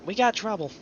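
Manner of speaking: sudden high alarmed call; shocked. Real, not acted. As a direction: blurt it out in alarm. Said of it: "'cause I'm in shock".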